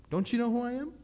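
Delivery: "Don't you know who I am?" with you pitched high and rising; high outraged take. Shown as a demonstration. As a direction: squeal it in mock outrage.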